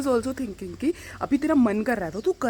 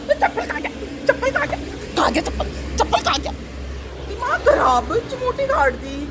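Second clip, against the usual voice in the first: high, sharp scolding of the dog over a missing slipper. Real, not acted. Woman scolding her dog Rambo for hiding the slipper, high and exasperated. Same clip replayed.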